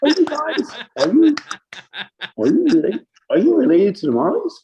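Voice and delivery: high, loud excited guy voice. Retold or imitated. [name] voiced meeting [name].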